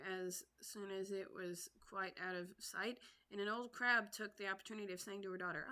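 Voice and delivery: plain reading voice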